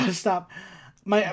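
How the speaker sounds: ordinary talk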